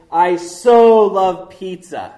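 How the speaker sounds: so pushed loud and slow, like a craving; gushing stress on so. Shown as a demonstration. Pastor mocking everyday gushing so, loud and slow. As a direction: lean on so as in I so love pizza. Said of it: "Like we say".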